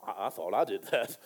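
low, soft dry irony; ironic God. Pretend. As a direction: tease it in a deep quiet voice. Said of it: "God Almighty saying".

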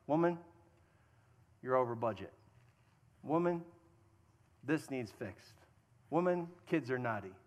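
low, terse mock-bossy complaints; fault-finding husband. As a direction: imitate a husband listing faults, with pauses. Mimics a husband only pointing out problems.

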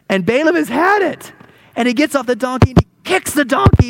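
loud, high, worked-up storytelling; exasperated. Acted. Preacher telling how Balaam lost patience with the donkey, exasperated. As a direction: build up Balaam's fury as you tell it. Narrating Balaam losing his temper.

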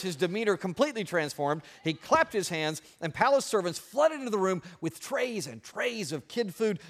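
usual speaking voice

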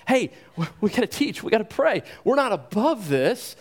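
high, keen voice of the apostles; rally the others, eager and sure; eager resolve. Retold or imitated. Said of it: "They're like".

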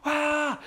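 high, mock stadium roar; drawn-out high "ah" like a crowd; imitated crowd roar. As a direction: imitate a distant crowd erupting. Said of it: "you hear a roar".

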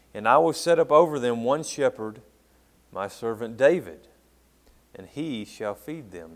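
loud, proclaiming read of the Lord's promise; solemn scripture reading. Acted. Pastor reading the Lord's words to his church, loud and solemn. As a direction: announce the promise with weight. Pastor reads Ezekiel aloud.

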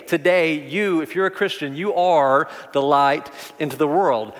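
normal talking voice

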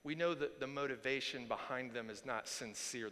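normal talking voice

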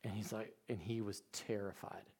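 normal talking voice

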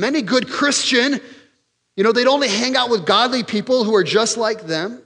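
lampoon a self-righteous churchgoer; mocking religious voice. Preacher to congregation, mocking the rule-keepers in a high voice.

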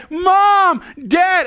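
high, loud desperate call; toddler crying out. Retold or imitated. Preacher voices a frustrated toddler calling for parents, loud and high. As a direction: cry out like a worn-out child. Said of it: "and they cry".

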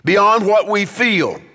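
high, slow, emphatic pulpit delivery; preaching exhortation. Real, not acted. Preacher calls church beyond its own feelings, forceful exhortation.

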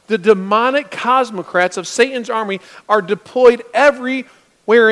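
rising preacher's fervor, then easing down; preaching. Acted. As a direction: build the warning with conviction. Preaching about demonic attack.

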